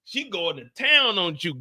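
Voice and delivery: ordinary talk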